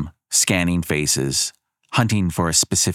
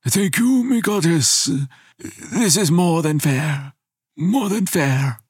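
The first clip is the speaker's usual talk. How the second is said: higher, slow relieved grandfather; grateful old man; 11 semitones higher, slower than usual. A narrator's reading. Grandfather thanks goddess humbly, slow and relieved.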